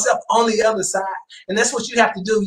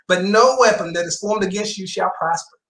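ordinary delivery

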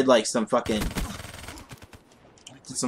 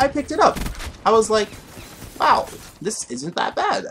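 excited; 10 semitones higher than usual. Retold or imitated. Brave snake pickup, pitched up.

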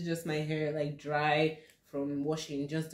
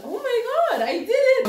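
high, loud, laughing cheer; triumphant; 8 dB louder than usual. Real, not acted. Vlogger finishing the last side, triumphant. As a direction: squeal with relieved triumph.

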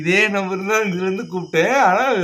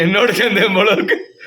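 real laughter through raised-pitch words; laughing; 5 semitones higher, 6 dB louder than usual. Real, not acted. Parent joking about the unanswered prank call, laughing. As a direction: talk through a laugh, voice lifting.

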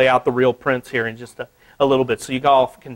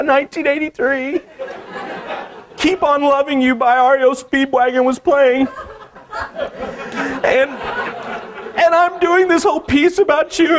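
much higher, giddy half-laughing confession; nervous confession; 13 semitones higher than usual. Retold or imitated. Artist confessing to Stephanie, high giddy nervous gush. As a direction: gush it all out, high and flustered. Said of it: "I was like".

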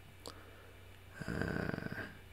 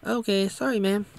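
higher-pitched mock apology; meek apology; 11 semitones higher than usual. He gives in to her at the door, meek apology. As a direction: say sorry in a raised, put-on voice. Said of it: "And he's like".

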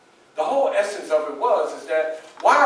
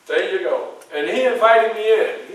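normal talking voice

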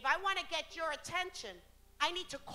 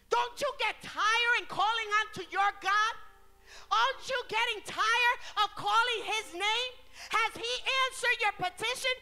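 loud, high, driving sermon voice; preaching at full force; 9 semitones higher, 7 dB louder than usual. Real, not acted. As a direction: hammer each phrase out to the congregation. (predicación encendida, fuerte y alta)

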